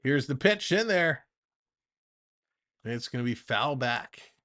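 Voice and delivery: lively baseball announcer delivery; play-by-play call. Acted. Streamer calling the Jays game for chat, announcer voice. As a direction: call the at-bat like a broadcast.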